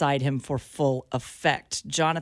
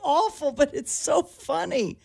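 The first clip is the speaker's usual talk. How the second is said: laughing; 10 semitones higher than usual. Real, not acted. Laughing at funeral prank.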